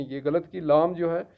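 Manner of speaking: ordinary talk, nothing marked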